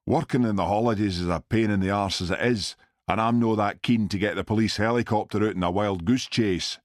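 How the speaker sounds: put-on grumbling in a Glasgow accent. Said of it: "in his heavy Glasgow accent".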